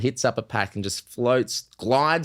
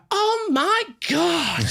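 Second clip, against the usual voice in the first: very high, slow, drawn-out gasp; mock a shocked middle-aged woman, stretched out; middle-aged women gasping; much slower than usual. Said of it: "The women would go".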